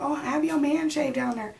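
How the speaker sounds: high, breezy retold advice; imitating a friend. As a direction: toss it off lightly as the friend.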